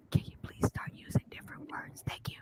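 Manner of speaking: demonstrated whisper on live. Shown as a demonstration. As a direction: whisper it right into the mic. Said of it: "I was whispering things".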